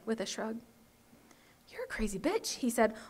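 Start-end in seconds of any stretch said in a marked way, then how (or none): 1.7-2.5 hushed, appalled whisper; horrified whisper. A narrator's reading. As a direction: breathe it out in horror.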